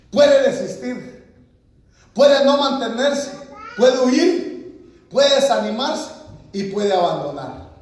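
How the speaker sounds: rising preacher's call; emphatic preaching. Real, not acted. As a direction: preach it out to the church.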